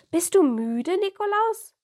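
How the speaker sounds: put-on high, bright little boy voice; child voice. Child Anton asks Nikolaus.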